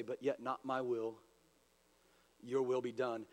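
low, soft, settled prayer; yielding surrender. Retold or imitated. We offer up the divinity side, surrendering softly. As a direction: drop low and quiet, giving up your will. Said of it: "we offer up that divinity side".

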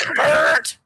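"brrrt" like a big gun; imitated gunfire. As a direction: mimic a rapid-fire gun burst.

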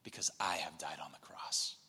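soft, low whisper; Jesus gently whispering to his disciples; whispered promise. Acted. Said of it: "and he's saying".

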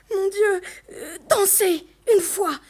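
high, loud, building longing; passionate yearning. A narrator's reading. Girl dreaming of dancing just once, passionate yearning. Girl's yearning inner cry for ball.

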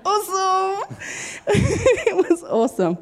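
high laughing awesome. Real, not acted. Woman recalling being wrecked in the Lord, laughing high. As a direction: bubble over with laughter.

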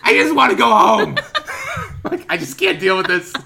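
loud, high, laughing mock despair; mock desperate plea. Stuck with the axis guy, laughing mock despair. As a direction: whine to escape, half laughing. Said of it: "And I was just like".

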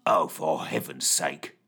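low, slow grumbling mutter. A narrator's reading. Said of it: "muttered Fitch".

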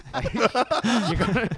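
words squeezed out through laughter; laughing. Real, not acted. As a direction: laugh while telling him off. Laughing at his own story.

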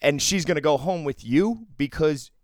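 indignant, punching out the accusations; still angry. Real, not acted. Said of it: "It still makes me angry".

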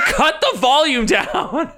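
high, drawn-out comic shriek; mock alarmed yell. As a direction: imitate someone startled by a blaring machine. Acts Jubilee startled by loud computer.

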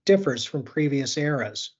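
podcast intro read. Acted.

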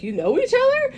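high rising incredulous question; retold surprise. Retelling Marianne learning they know each other, surprised. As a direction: ask it high, acting surprised.

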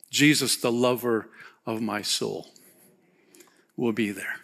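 gentle preacher voice, almost confiding; tender reflection. Acted. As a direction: ease into a hushed, loving close. Tender close about heaven.

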